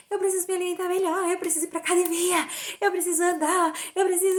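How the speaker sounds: high, fast, tearful wailing of what she must do; desperate crying. Retold or imitated. To her husband in the car, high desperate sobbing plea. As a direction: retell the panic as if sobbing it out again. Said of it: "Um desespero".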